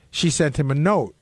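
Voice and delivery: ordinary talk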